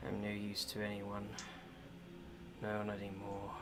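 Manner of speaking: very soft, low, dry rasp; a hopeless prisoner barely speaking; raspy, hoarse voice. Acted. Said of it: "very hoarse voice say".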